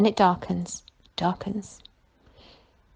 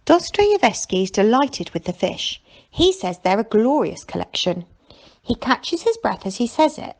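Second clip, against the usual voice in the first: usual speaking voice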